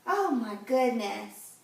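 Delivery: plain reading voice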